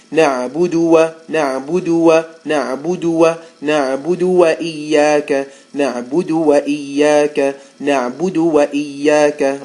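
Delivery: na'budu wa repeated loud, wa split off; drill the error. Shown as a demonstration. Teacher drilling the mistake students should catch. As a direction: chant the wrong split as a drill. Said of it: "Like, just say it".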